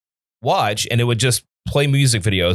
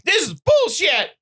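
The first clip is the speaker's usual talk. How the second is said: high-pitched retold indignant yelp; mock outrage. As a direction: squeal it in retold disgust. Retold outrage at censored song.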